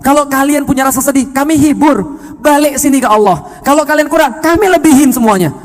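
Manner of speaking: voicing Allah's promise. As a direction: call them back with rising fervour. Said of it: "kata Allah".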